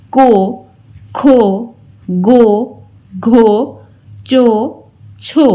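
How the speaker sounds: rounded ओ ending on को गो घो; o vowel drill. Shown as a demonstration. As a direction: round the lips for को. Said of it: "फिर इसे बोला जाता है". (ओ की मात्रा वाली ध्वनि)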